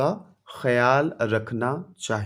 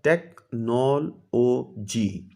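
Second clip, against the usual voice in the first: Technology said slowly, split into its four syllables; teacher stretches technology out to show its syllables; syllables spelled out; 4 semitones lower, 3 dB quieter, much slower than usual. Shown as a demonstration. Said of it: "four syllable word है". (Technology को धीरे धीरे चार हिस्सों में बोलें)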